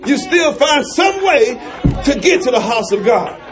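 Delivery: rising, shouted sermon call; climbing preacher's shout; preaching. Acted. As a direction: preach it hard, building. Preaching at full force.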